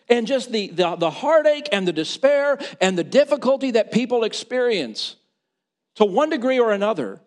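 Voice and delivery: high, loud pulpit voice; impassioned preaching. Real, not acted. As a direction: preach it with full conviction. Preaches on God's mercy.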